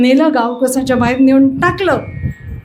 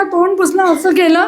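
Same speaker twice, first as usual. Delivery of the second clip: usual speaking voice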